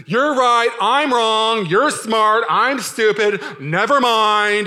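loud, high mock concession; exaggerated huffy giving-in, last words drawn out; passive-aggressive. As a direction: concede loudly while plainly not meaning it. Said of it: "passive-aggressive fighter".

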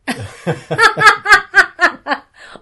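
loud, high cackling laugh; loud cackle. Real, not acted. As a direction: burst into a big cackle. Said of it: "Oh, sorry, that was too loud".

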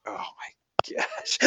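slow, whispery retold disbelief; hushed dismay. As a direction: let it out slowly under your breath. Retold dismayed reaction to joke.

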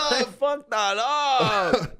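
slow, high, laughing confession; drawn-out high laughing groan. Real, not acted. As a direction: laugh it out as you admit the slip. Laughing mock regret.

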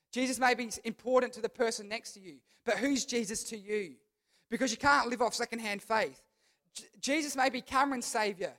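ordinary delivery

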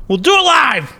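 hammed-up O'Reilly meltdown; Bill O'Reilly rant. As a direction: yell it like a host losing his temper.

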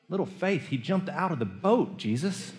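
can you believe this; incredulous. Real, not acted. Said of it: "incredulous protest at Jesus".

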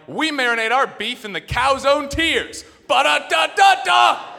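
high, slow jingle building to a loud sung shout; mock commercial. McDonald's commercial bragging about its beef, hammed-up ad voice. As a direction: sell it like a cheesy ad then belt the jingle. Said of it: "McDonald's come out with a commercial".

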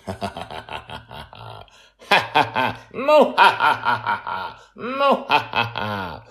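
hammed-up villain cackle; mock evil laugh. Host teasing the plot with a hammed-up villain cackle.

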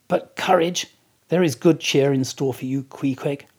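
rousing encouragement. Acted. Narrator cheers on Queequeg.

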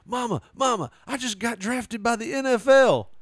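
high, loud shout to Mama; excited son. Acted. Guy walking through his house calling his mother, excited high shout. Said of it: "He's like".

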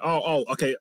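ordinary delivery

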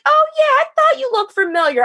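very high, quick, phony chumminess; mock friendly recognition. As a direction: feign delight at knowing the customer. Dealer suddenly friendly to dad.

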